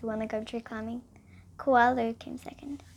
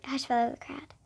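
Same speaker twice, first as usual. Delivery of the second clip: ordinary delivery, nothing marked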